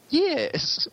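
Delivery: very high-pitched silly game-character voice; NPC voice. Shown as a demonstration. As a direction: squeak it like a cartoonish NPC greeting. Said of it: "It's not like my N P C voice".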